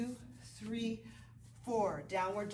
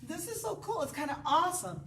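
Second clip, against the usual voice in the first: delighted body voice; 6 semitones higher than usual. Retold or imitated. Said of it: "It's like".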